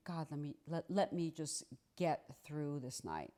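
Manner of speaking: low, hushed private plea; quiet prayer. Retold or imitated. Wife praying in bed to get through the night, hushed. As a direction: pray softly, voice sunk down.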